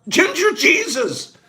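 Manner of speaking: Ginger Jesus called out high and slow; delighted exclamation. Real, not acted. Host proclaiming Jeff's nickname to viewers, delighted exclamation. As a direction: savour "Ginger Jesus" like a big reveal. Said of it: "he's called Ginger Jesus".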